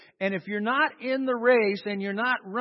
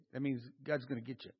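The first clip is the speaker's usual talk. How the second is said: ordinary talk, nothing marked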